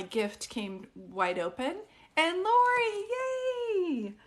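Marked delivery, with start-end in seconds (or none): 2.2-4.1 high, bright yay for a viewer; squealed welcome, much higher; excited cheer. Real, not acted. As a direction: cheer her name like a friend arriving.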